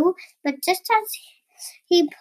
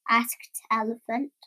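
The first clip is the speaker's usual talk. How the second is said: ordinary delivery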